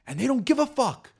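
put-on excited kid. Kid self admiring rappers.

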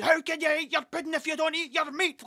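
high, hammed-up screaming schoolmaster; mock strict teacher yelling the famous line. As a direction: bark it like the teacher from The Wall. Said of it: "Like the guy at, uh, uh, The Wall".

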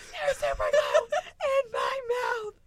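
high, slow wail through laughs; half-sob, half-laugh shout; crying laughing friend. Retold or imitated. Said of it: "And she's like".

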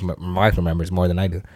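ordinary delivery

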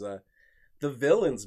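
ordinary delivery

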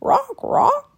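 very high, squawking bird; excited bird call; raven croak. A narrator's reading. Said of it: "croaked the raven".

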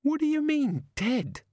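high-pitched, incredulous demand. A narrator's reading. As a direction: demand an answer, voice leaping high. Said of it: "Susan demanded".

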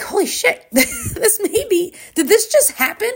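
high-pitched, giddy disbelief with a laugh; retold amazement. Winner realizing it with coach, high giddy amazement. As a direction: gasp it out high, laughing in wonder.

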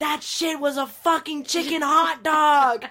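loud, slow, outraged; slow indignant yell; indignant shout. Real, not acted. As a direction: spit it out slowly in disgust. Indignant punchline.

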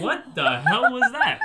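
loud, high, drawn-out disbelief; retold shock. Driver recounting the bump to friends, shocked outburst. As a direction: exclaim it slowly, hitting it hard. Retelling shock when car was hit.

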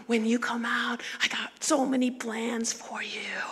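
soft whispered promise; whisper to the belly. Retold or imitated. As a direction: hush it to the baby inside. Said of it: "You start talking to them".

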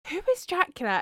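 lightly voiced clueless mum; imitating mum. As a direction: ask it high, as a baffled parent. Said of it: "My mum was like".

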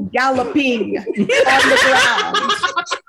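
can barely get the words out for laughing; cracking up at the punchline of her own story. Real, not acted. Laughing while telling the punchline.

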